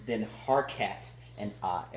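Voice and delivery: usual speaking voice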